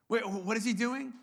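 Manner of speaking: ordinary talk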